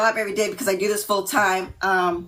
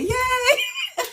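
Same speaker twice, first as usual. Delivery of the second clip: high squealed yay with a laugh; excited cheer. Real, not acted. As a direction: squeal it out with delight. Excited cheer about granddaughter.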